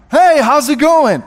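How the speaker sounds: loud, high, hearty reply; imitating excited strangers. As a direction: greet back as delighted strangers, full voice. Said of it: "they're very excited to see us".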